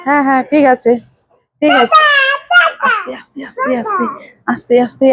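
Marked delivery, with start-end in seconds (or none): 2.9-5.0 gentle hush. Real, not acted. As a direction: hush the little one softly, pitch lifted. Hushing a shouting child.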